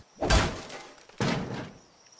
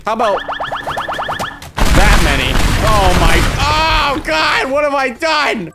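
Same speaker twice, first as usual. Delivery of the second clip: high, loud alarmed cries; half-laughing real panic; panicked; 9 semitones higher, 7 dB louder, much slower than usual. Real, not acted. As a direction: yell as the fire spreads. Fire spreading, panicking.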